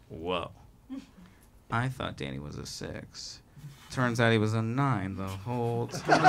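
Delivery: low, soft impressed murmur; impressed onlookers. Retold or imitated. Strangers quietly impressed seeing him with his girlfriend. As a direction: mutter it like awed strangers. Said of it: "people were gonna be like".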